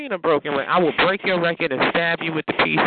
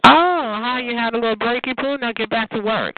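higher, sing-song teasing; 7 semitones higher than usual. Real, not acted. Host mocks caller returning from break.

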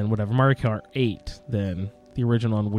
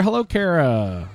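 usual speaking voice

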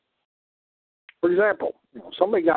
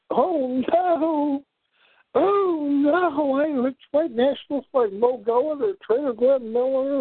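high sarcastic whiny voice; mock the accusation in a high voice; mocking falsetto; 12 semitones higher, slower than usual.

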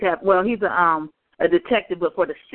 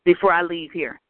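ordinary talk

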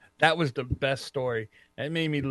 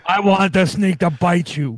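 louder wrestler impression; Macho Man; 5 dB louder than usual. Co-host mimicking Macho's demand, loud wrestler impression. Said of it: "you sound like Macho Man".

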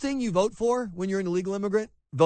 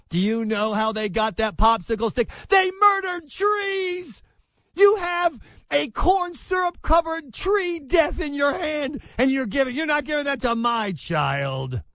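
mock outraged shout; 7 semitones higher than usual. Host acting a hysterical parent over popsicles, hammed-up shouting. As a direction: scream it like a hysterical activist parent.

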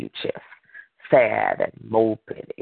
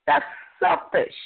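very high, emphatic exhortation; forceful preaching; 12 semitones higher than usual. Real, not acted. Preacher telling listeners to bear afflictions and walk on, forceful. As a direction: drive each point home in a high preaching voice.

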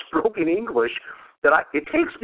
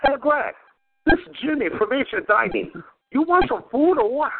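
higher, light broken-English owner call; brisk pushy phone pitch, lightly voiced; imitating Jimmy; 8 semitones higher than usual. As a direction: owner calling a customer who skipped Friday, light mock voice. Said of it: "I get a phone call".

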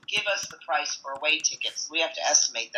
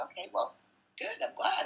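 sarcastic murmur; 14 semitones higher, 7 dB quieter than usual. Retold or imitated. Sarcastic reply to the buyer.

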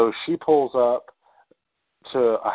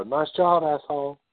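half-hearted retold shout, pitched up; mock yell; 6 semitones higher than usual. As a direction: imitate a heckle out a car window. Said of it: "they yell out the window".

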